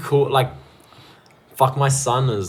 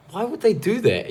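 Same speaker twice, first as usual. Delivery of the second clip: high-pitched imitation of a puzzled child; father mimicking his son's innocent question; young son's questioning; 11 semitones higher than usual. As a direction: voice a small kid asking why, pitched way up.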